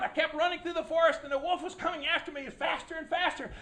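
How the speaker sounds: normal talking voice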